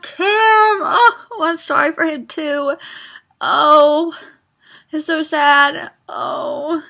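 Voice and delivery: real upset, high-pitched plaintive outpouring. Real, not acted. Reader apologizing to heartbreaking book characters, upset. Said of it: "starting to get a little upset".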